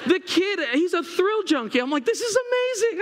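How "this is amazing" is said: very high, thrilled exclamation; high-pitched elation; amazed delight. Retold or imitated. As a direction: re-enact an overjoyed dad. Retold delight at thrill-junkie son.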